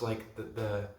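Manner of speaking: ordinary talk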